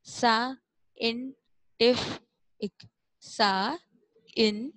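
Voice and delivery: साइंटिफिक very slowly as sa, in, tif, ik; broken into syllables. Shown as a demonstration. Teacher breaking a maths term up for pupils, syllable by syllable. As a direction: draw out साइंटिफिक with a pause after every syllable. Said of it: "अब इसको जल्दी जल्दी read करें".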